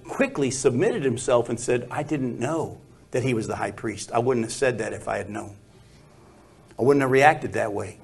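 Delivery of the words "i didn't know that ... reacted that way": meek apology. Retold or imitated. Paul submits after rebuke.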